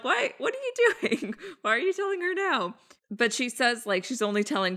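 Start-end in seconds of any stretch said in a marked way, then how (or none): 0.0-2.7 much higher disbelieving questions; high, incredulous protest at Lily; exasperated why. Real, not acted. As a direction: plead with a character in disbelief. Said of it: "I'm like".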